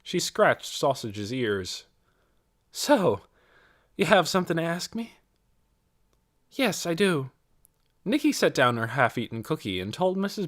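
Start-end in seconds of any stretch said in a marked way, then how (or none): 2.7-7.3 a hint of a girl's higher voice; lightly voiced young girl. A narrator's reading. Nicky answers Mrs. Beeson, lightly voiced as a girl. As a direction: lightly voice Nicky, eager and polite.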